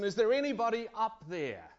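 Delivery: high, loud cry for help; desperate call. Acted. The dangling climber calls up for help, desperate. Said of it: "calls out in his desperation".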